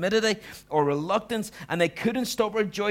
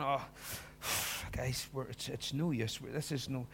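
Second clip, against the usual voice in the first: weary, hushed, hopeless sigh of defeated followers; despairing sigh; 4 semitones lower, 10 dB quieter than usual. Retold or imitated. Disciple to friends, giving up, hushed despair. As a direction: let the words sag out with a sigh.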